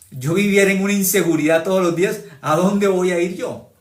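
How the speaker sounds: preaching at full force; impassioned cry. Real, not acted. Preacher recalls old insecurity, rising.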